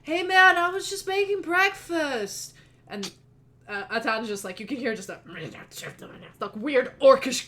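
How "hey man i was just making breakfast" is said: much higher and louder, slow, guileless dude; innocent stoner. Acted. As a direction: protest innocently, stretching it out. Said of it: "Oliver goes like".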